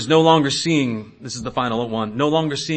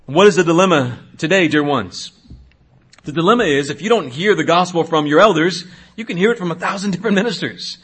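normal talking voice

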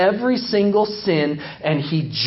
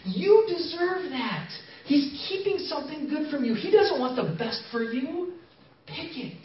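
higher, hushed coaxing serpent voice, softer; tempting Satan whisper; 10 semitones higher, 5 dB quieter than usual. Acted. Satan whispering to Eve in a skit, hushed and coaxing. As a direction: lean in and tempt her quietly. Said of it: "I'm whispering in Eve's ear".

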